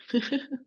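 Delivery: short laugh in a quick burst; light chuckle. Real, not acted. Teacher reassuring Anne-Laure she will explain everything, chuckling. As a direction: chuckle briefly.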